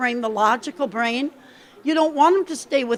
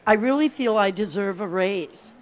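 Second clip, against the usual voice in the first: lower, even, insistent repetition; broken record; 6 semitones lower, 3 dB quieter than usual. Shown as a demonstration. Coach demonstrates asking for a raise over and over, evenly insistent. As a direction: calmly state it like a broken record. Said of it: "say over and over again".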